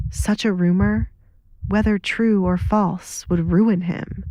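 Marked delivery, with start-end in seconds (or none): none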